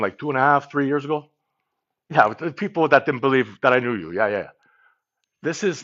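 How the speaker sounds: put-on New York tough-guy accent. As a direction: play a bragging New York guy who claims he trained Stallone, in a light accent. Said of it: "that's not the accent".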